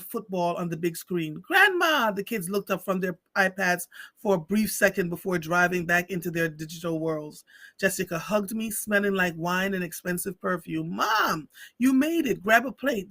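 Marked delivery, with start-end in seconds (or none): 1.4-2.1 high, loud happy shout of Grandma!; excited kids calling. Retold or imitated. Kids greet grandma at door. 10.9-13.1 higher, a touch brisk and offhand; breezy daughter. Retold or imitated. Daughter greets mother at door.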